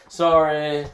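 flat, grudging mock apology; half-hearted monotone sorry. Older child made to apologize to younger, half-hearted monotone. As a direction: mimic a kid's insincere, monotone sorry. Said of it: "this half-hearted looking the other way".

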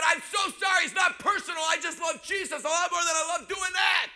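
shouted preaching, high and loud; pleading apology preached at the top of the voice. Retold or imitated. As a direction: preach through the door at full force. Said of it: "I preached".

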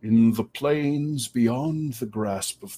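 slow, measured voice of an ancient forest giant; unhurried and solemn, as if it has waited for ages; slow ancient guardian. Acted. Forest guardian answering the traveler, slow and solemn.

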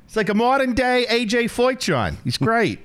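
hammed-up high New York accent; mock New York accent about watching Donnie Schatz; terrible New York accent. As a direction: play a brash New York guy in a bad New York accent. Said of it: "We're just doing terrible New York accents now".

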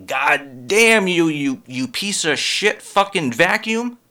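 slow, drawn-out cursing at a vacuum; mock cursing. Comic cursing a broken vacuum, slow mock rage. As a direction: drag out the curse at the vacuum. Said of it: "when I say".

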